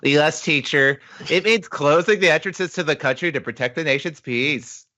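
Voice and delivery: high-pitched pupil voice; little schoolkid. Acted. Schoolchild answering the teacher, high reciting voice. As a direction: answer in a high, put-on child voice. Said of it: "a classroom of little kids".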